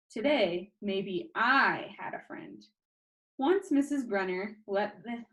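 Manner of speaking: plain reading voice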